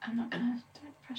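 embarrassed whispered refusal; whispering. Real, not acted. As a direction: whisper it, backing out. Refuses to do the accent.

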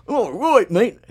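high, loud hammed-up Australian accent. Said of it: "do an Australian accent".

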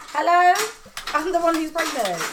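mock indignant. Mum fussing over Lisa instead.

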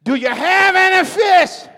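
Jesus hollering from the shore to the boat; Jesus calling out. Retold or imitated. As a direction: shout it up high across the water. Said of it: "This how the dialogue goes".

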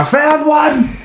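high-pitched guard's shout; acted shout. Guard announcing a captured runaway to others, acted shout. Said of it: "and he shouts".

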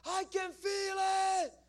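high, loud, drawn-out mock scream. Guy screaming across the room during prayer, screaming. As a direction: imitate a man screaming at the top of his voice. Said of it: "a guy starts screaming".

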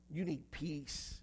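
slow, very soft appeal; hushed, tender. Real, not acted. Preacher to someone needing peace, hushed and tender. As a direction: drop right down, slow and gentle.

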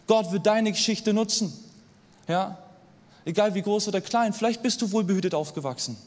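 normal talking voice

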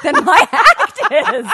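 laughing through the words; cracking up mid-sentence. Real, not acted. Laughing at own punchline.

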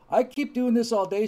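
high, loud dictator's threat; mock Stalin yell. Stalin threatens the terrified crowd, a loud mock yell. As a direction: bark it out like a tyrant losing patience. Stalin voiced in retold joke.

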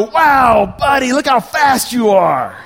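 high, loud, drawn-out cheer; over-the-top proud dad amazement; gushing mock praise. Dad pretending to cheer his son's dangerous running, gushing mock praise. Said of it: "I'll tell you what I didn't say".

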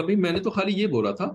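high, protesting innocence; acted innocent excuse. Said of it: "तो वो कहेगा".

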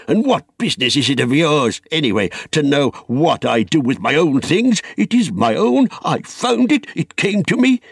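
high, heated possessive outburst; indignant, climbing protest; angry. A narrator's reading. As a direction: build in pitch as you claim it as yours. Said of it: "there was an angry light in his eyes".

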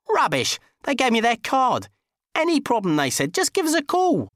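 high, loud outraged protest; indignant Dickie. Acted. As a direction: insist he's right.

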